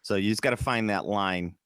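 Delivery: normal talking voice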